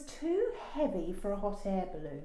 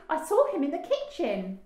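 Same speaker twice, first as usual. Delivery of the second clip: put-on high, loud child's insistence; crying out; 9 semitones higher, 7 dB louder, faster than usual. A narrator's reading. Daisy tells parents of the rhino, crying out. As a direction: insist loudly that it's true. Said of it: "cried Daisy".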